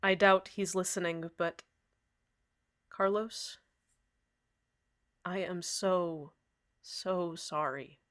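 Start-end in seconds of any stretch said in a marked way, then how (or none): 2.9-8.0 heartfelt apology. Acted. Apology to Carlos on air.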